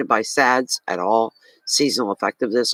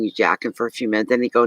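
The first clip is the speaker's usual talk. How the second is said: ordinary talk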